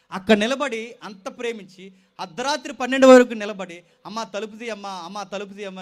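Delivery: pleading sermon retelling. Acted. Pleading parents, preached.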